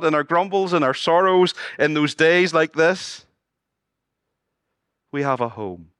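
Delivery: ordinary delivery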